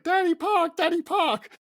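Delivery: very high, whiny mock toddler wail; crying toddler. Three-year-old son told no park, very high mock crying whine. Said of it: "And immediately he starts crying".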